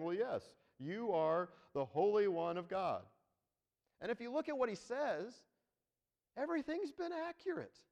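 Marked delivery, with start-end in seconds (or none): 0.0-3.1 convert at altar call. Acted. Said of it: "altar call and saying".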